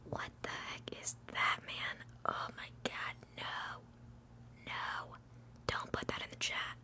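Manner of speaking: hushed protest at the chat; whispered "oh no" reaction; whispered dismay. Real, not acted. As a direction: whisper it as a drawn-out objection.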